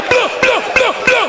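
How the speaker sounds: high-pitched mouth-made gunfire; rapid-burst gunshot noises; imitated gunshots. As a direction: fire off short high shots with the voice. Imitates gunshots after lick shot.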